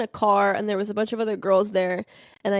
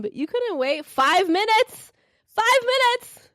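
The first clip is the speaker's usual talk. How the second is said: high, slow, building; outraged; 12 semitones higher, slower than usual. Retold or imitated. To her girlfriend over the seats, high slow outrage. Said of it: "I was like".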